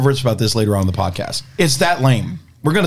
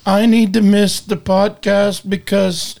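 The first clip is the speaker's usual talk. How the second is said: higher, slower, hesitant voice imitating a nervous co-host; mock nervous quiver; 6 semitones higher, slower than usual. Imitating a nervous co-host calling to skip the podcast, higher and slow. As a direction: put on a shaky, halting nervousness, a bit hammed-up. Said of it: "you could hear the quiver in his voice".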